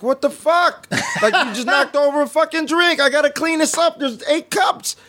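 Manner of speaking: high, heated yelling at a clumsy customer; retold yelling. Barista yelling at customer who spilled a drink, high heated yell. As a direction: bark it out, high and worked up, half laughing.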